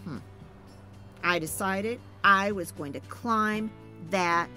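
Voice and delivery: ordinary delivery, nothing marked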